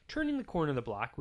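ordinary delivery

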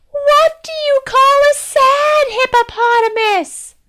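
high, loud princess voice; princess asking a riddle. Acted. Princess teasing the guard with a riddle, high voice. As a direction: pitch the princess way up, bright and playful.

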